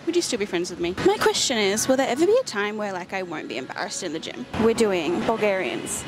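ordinary talk

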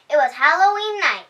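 ordinary delivery, nothing marked

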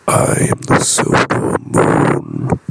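hushed, whispered moon voice. Acted. The moon speaks to James.

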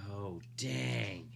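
ordinary delivery

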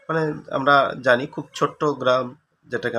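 ordinary talk, nothing marked

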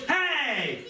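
a short, half-laughing "Hey!" thrown back; mock angry retort. Shown as a demonstration. Preacher acts out hitting back at someone yelling, a mock angry snap. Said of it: "Are you gonna do this?".

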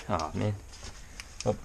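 ordinary delivery

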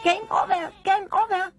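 very high-pitched toy squeal; shrieking. Acted. Story toy shrieks so that others cannot bear it, shrieking. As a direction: squeal it out like an alarm. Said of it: "Strillava così forte".

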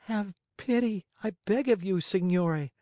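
very high, pitiful puppet voice; high-pitched tearful plea; pitiful pleading. A narrator's reading. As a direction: beg like a weeping little puppet. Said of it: "asked in a pitiful voice".

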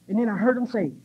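normal talking voice

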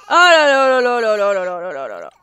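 long, loud falling lament; dismayed lament. Real, not acted. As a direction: sigh out the chaos. Babies both crying. (longue lamentation descendante)

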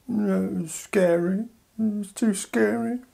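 put-on high, slow, frightened little child; scared child. UK as child afraid of jumping, high scared whimper.

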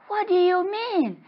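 very high, loud startled question; high-pitched incredulity; acted bafflement. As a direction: squeal the question in mock alarm. Acted startled reply to ghost news.

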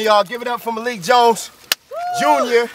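usual speaking voice

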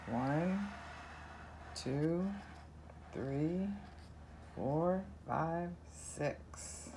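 usual speaking voice

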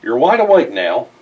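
usual speaking voice

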